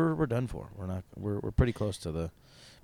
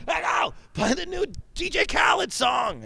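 high, loud, slow call to a device; hammed-up loud order to an assistant; shouted voice command; 4 dB louder, slower than usual.

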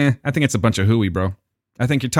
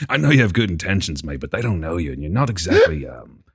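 lower, tactful English friend; English accent; 7 semitones lower than usual. Acted. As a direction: break it gently, English and understated. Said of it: "he's like".

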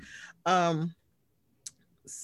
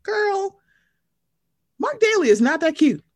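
high, loud sassy telling-off; sassy scolding; 7 semitones higher, 4 dB louder than usual. Retold or imitated. Podcaster telling a reality star to drop her man, sassy. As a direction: scold her like a fed-up girlfriend.

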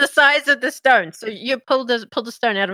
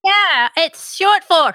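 higher, slow little imp; 8 semitones higher, 4 dB louder, much slower than usual. Acted. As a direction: chipper servant imp. GM voices imp.

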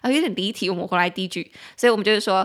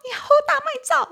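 high and fast, like yelling at someone fleeing; mock chase shout; 4 dB louder, much faster than usual. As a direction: shout after a runaway, hammed-up. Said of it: "叫他们说".

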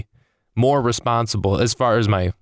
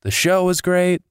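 imitating a thrilled friend; 7 semitones higher than usual. Comedian voices friends thrilled by a great free show, high and gushing. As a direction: gush like a friend after a great night. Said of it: "you're like".

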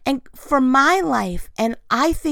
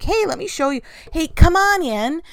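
higher, louder, inviting someone in; mock eager invitation; 6 semitones higher, 4 dB louder than usual. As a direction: play an over-eager host waving guests in.

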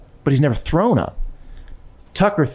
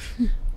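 laugh; 10 semitones higher, 7 dB quieter than usual. Real, not acted. Laughs at own plan.

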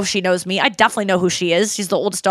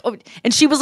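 ordinary talk

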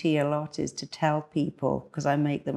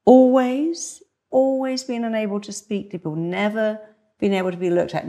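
incredulous, questioning coach; challenging coach; 5 semitones higher, 5 dB louder than usual. Acted. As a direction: doubt every word they claim. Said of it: "a great coach will challenge them".

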